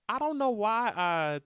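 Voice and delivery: lightly voiced high old lady; a hint of a high, slow granny voice. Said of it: "That's my old lady voice".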